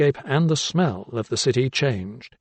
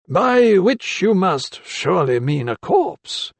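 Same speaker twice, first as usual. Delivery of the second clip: put-on higher, slow, dry voice of an elderly doctor; slow higher old-man voice, probing; 7 semitones higher, slower than usual. A narrator's reading. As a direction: let the old mentor needle him, slowly.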